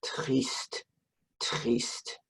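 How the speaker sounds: soft, whispered sad triste. Shown as a demonstration. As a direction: breathe "Triste" out quietly and sadly. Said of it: "Make your face sad".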